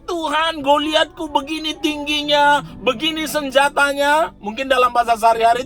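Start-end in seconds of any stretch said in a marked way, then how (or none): 0.1-4.3 high, pleading complaint to God, lightly hammed up; mock whiny prayer. Preacher mimics believer moaning to God, high mock whine. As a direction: whine upward like a worried believer listing troubles.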